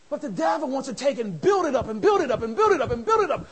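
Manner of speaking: loud, building sermon voice; driving preaching. Acted. Preacher warning the church about the devil. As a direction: push each repeat harder.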